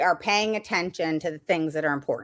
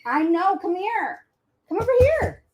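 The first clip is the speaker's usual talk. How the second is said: higher coaxing call to a puppy; 11 semitones higher than usual. Real, not acted. Host calling her puppy over mid-webinar, high coaxing call.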